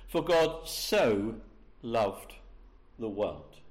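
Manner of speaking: slow, weighted "For God so... loved... the world"; stress on so. Shown as a demonstration. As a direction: lean hard on "so" and let "loved the world" drop. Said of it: "That word "so".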